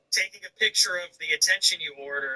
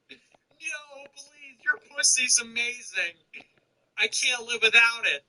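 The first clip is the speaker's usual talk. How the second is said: mock pleading; 8 semitones higher, slower than usual. Mocking pleading lover.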